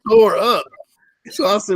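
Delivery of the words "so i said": usual speaking voice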